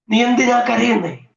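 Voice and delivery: loud, high-pitched repeat of the question. Acted. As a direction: lift the voice and press the question. Same question repeated, raised.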